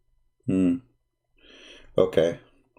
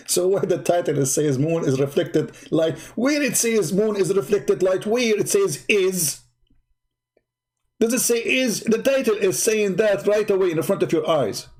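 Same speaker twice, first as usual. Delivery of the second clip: higher, insistent scornful questions; exasperated mockery; 6 semitones higher than usual. Real, not acted. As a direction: hammer at the critic's mistake. Mocking a critic's claim.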